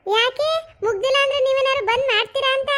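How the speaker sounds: high, loud snappy retort from the daughter-in-law; snapping wife. Acted. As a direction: bite back sharply at the husband. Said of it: "ಈ ತರ ಕಿರ್ಚಾಡ್ತಾಳೆ ಇವಳು".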